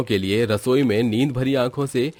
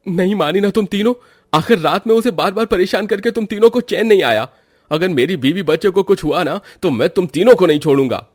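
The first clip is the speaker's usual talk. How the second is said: higher, louder angry shout; furious yelling; 7 semitones higher, 4 dB louder than usual. Acted. Husband yells at his three sisters, furious. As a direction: lash out at his sisters in fury. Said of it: "क्यों चिल्ला रहा है मेरी बेटी पर?".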